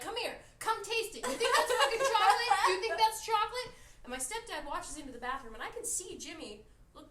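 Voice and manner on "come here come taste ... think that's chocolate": loud, high mocking yell; furious sister. Larissa yelling at the stepdad to taste it, furious. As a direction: shout the challenge at the stepdad. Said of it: "And Larissa's like".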